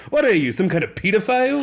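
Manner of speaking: loud, high mock vampire accent; Laszlo voice. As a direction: bark it like a theatrical vampire. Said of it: "second thing he goes is".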